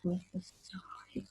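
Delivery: breathy whisper, unvoiced; soft whispered afterthought; whispered aside. Real, not acted. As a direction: whisper it to yourself. Whispered aside mid-sentence.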